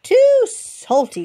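high, loud and slow little rabbit whine; fussy bunny. Acted. Boo rejects the butter, high, loud and drawn out. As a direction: stretch it out like a picky bunny.

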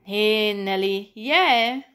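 slow "Nee, Nelly", then loud stress on "jij"; emphatic hand-back, leaning hard on "jij"; insistent. A narrator's reading. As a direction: draw out "Nee", punch "jij" loudly. Insisting the friend is winner.

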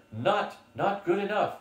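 half-acted out of breath, stammering; mild breathless pant. Frightened schoolmaster panting his refusal, lightly breathless. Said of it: "he panted out of breath".

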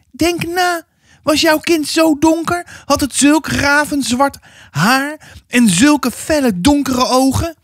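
very high, pressing woman's voice; insistent neighbour woman. Acted. Insistent neighbour woman questions the mother. (indringende buurvrouw, hoge stem)

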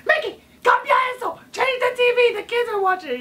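high, shrill scolding mom; Spanish mom voice. Retold or imitated. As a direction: yell like a panicked Spanish mom. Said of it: "my mom was so Spanish".

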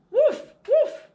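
high, loud playful puppy woof; puppy barking. Acted. Teacher reading to children, barking as the puppy: high loud woofs. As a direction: bark like an excited little dog.

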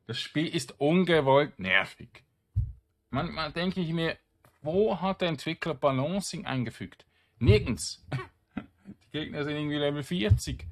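real exasperated rant; annoyed rant. Real, not acted. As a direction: vent about the developers. Rant about game balancing.

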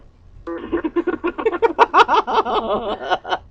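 big high burst of laughter; loud laugh. Real, not acted. Friends teasing, bursting out laughing. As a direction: crack up loudly at the joke.

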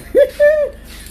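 high-pitched laugh; high giggle. Real, not acted. Taunting them not to forget, high giggle. Gloating about cussing people out.

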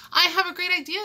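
high, loud burst of excitement; excited, ringing announcement; exclaiming. A narrator's reading. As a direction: exclaim it like a sudden brainwave. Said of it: "exclaimed Kansas".

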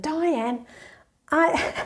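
light imitation of a southern English accent; a friend calling the name in a mild southern English accent. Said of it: "accent from down south in, in England".

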